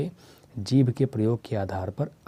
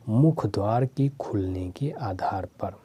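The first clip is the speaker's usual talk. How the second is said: slow whispered heading; hushed, unhurried whisper; 3 semitones lower, slower than usual. Shown as a demonstration. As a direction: breathe the words out slowly with no voice. Teacher repeats the heading.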